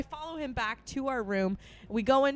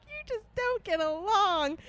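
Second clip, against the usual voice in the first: mock tearful whine; 8 semitones higher than usual. Drunk friend complaining to the pair, imitated whine. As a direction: whine it high like a weepy drunk.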